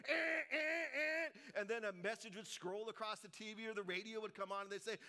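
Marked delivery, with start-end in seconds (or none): 0.1-1.6 loud, high, drawn-out buzzer imitation; annoying alert buzzer. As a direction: blare it like an emergency broadcast tone. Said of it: "that annoying sound would come on".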